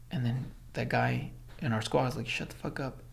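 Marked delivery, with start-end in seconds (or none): 2.3-3.0 hushed shut up. Retold or imitated. Hushing the squad near the instructor.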